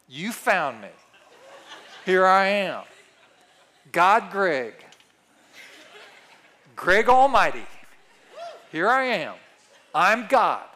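hammed-up self-proclaimed god; joking grandiose. Preacher jokingly playing God Greg.

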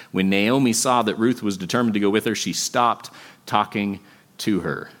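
ordinary talk, nothing marked

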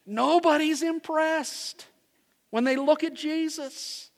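fiery sermon delivery; preaching at full force. Acted. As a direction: proclaim it to the whole congregation. Preacher builds the opening passage.

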